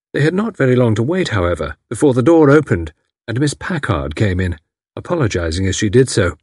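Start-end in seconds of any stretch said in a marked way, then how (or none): none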